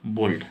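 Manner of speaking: बोल्ड with a half ल and a full ड. Shown as a demonstration. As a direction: clip the ल and land on ड in बोल्ड. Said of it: "आधा ल और पूरा ड". (बोल्ड में आधा ल और पूरा ड)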